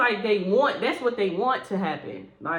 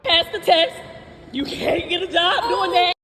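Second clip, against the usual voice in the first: higher, louder laughing taunt; gloating shout; 9 semitones higher, 7 dB louder than usual. Retold or imitated. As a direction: yell the insult gleefully.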